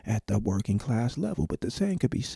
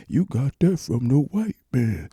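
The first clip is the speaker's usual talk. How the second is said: a put-on voice, a bit higher and louder; imitating a colonized mind; 4 semitones higher, 5 dB louder than usual. Mimicking people with a colonized mind, light mock sneer. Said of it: "in their mind".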